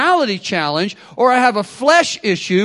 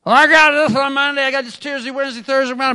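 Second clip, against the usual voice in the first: louder, higher mock excuse; mock busy guy; 6 semitones higher, 3 dB louder, faster than usual. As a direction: rattle off the busy week, hammed up. Voicing busy excuse-maker.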